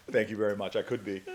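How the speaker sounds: chuckling through a joking thank you; laughing reply. Retold or imitated. Senator jokes to the woman asking if he's an athlete, laughing. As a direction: laugh it off, lightly amused.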